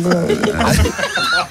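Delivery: a long, high burst of laughter; laughing hard at the caller's mishap. Real, not acted. Host laughs at curtain rod.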